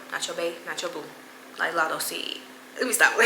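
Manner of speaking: ordinary delivery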